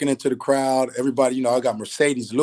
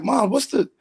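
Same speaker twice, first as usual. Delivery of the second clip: higher, exasperated pleading at mom; frustrated son; 7 semitones higher than usual. Retold or imitated. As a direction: push back at her, voice climbing. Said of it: "I get a little frustrated at Mom's".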